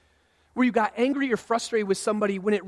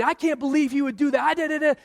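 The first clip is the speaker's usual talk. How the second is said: higher-pitched acted rant at a spouse; mock yelling; 6 semitones higher than usual. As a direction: imitate an indignant marital row. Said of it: "you're yelling at your spouse".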